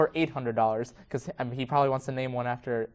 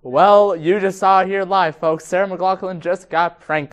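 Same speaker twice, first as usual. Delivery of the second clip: loud TV host announcing; announcer voice; 5 semitones higher, 9 dB louder, slower than usual. Pretend. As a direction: announce it to the folks. Mock announcer sign-off.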